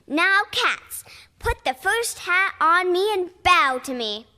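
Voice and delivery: high, loud showman voice; bossy command. Acted. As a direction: lord it over the cats. Peachy orders the cats.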